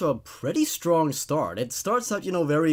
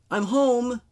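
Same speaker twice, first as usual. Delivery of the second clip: higher, louder call into the house; announcing arrival; 8 semitones higher, 4 dB louder than usual. A narrator's reading. Girl home from school calls out to her parents, loud announcement. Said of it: "I announced".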